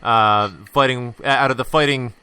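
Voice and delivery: lightly hammed-up guest introduction, building; mock boxing-ring intro for the guest; announcer intro. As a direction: bring him on like a ring announcer.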